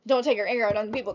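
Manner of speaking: usual speaking voice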